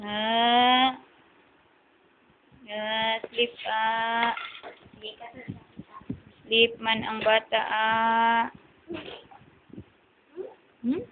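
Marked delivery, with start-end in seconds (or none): 0.0-8.4 soothing lull. Real, not acted. Lulling toddler to sleep.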